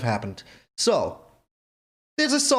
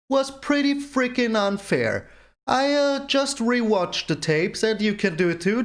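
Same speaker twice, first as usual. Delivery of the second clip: ordinary delivery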